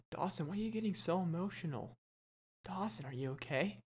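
softly voiced sidekick checking in; hushed concern. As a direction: ask softly, as a pretend second person. Imagined co-host checks on him.

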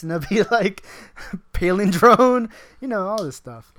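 usual speaking voice

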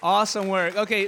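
ordinary talk

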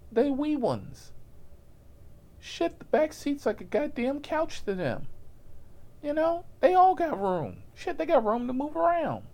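high, light passenger voice. Retold or imitated. The driver mimics the small passenger, high and eager.

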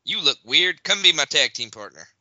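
lightly voiced wrestler making a blunt offer; imagined wrestler. Wrestler recruiting a partner backstage, lightly acted blunt pitch. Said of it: "was like".

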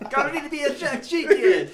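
over-the-top island lilt, Caribbean; mock Caribbean accent. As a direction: belt out a hammed-up Caribbean accent.

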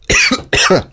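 real cough after straining the voice. Real, not acted. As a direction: cough hard, throat strained by the voice. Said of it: "Can't do the voice without coughing".

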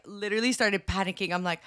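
normal talking voice

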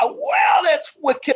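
high-pitched mock scream of celebration; excited scream. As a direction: squeal it in delight, reliving the moment. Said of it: "scream in the car".

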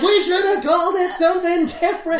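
mock settler. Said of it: "They're like".

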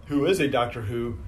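ordinary delivery

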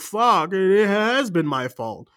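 mocking, melodramatic dawning horror; mock dramatic realization. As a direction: overact the sudden guilt. Voices heroine's late realization.

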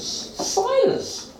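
can't believe the name Silas; incredulous Silas. A narrator's reading. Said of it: "What a rubbish name".

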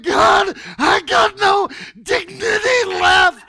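high, loud wail to God; hammed-up crying shout. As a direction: re-enact a tearful, desperate scream. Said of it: "I'm crying and I said".

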